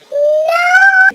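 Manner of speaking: very high, loud, drawn-out shriek; screamed no. Retold or imitated. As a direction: shriek it long from another room. Host mom shouting from background.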